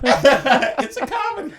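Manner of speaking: laughing protest that it's just a comedy; laughing insistence. Real, not acted. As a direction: insist through a big laugh. Repeats insisting, laughing loudly.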